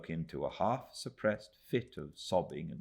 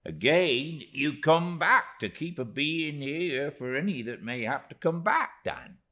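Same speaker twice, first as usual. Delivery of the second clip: put-on higher earnest old widow voice; a widow vowing, raised and firm; Mrs Gummidge voiced; 9 semitones higher, 6 dB louder than usual. A narrator's reading. Widow to Mr Peggotty, earnest vow.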